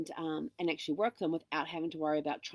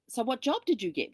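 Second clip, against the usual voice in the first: eager question; 8 semitones higher, 5 dB louder than usual. Retold or imitated. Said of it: "And he goes".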